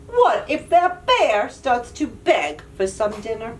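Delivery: ordinary delivery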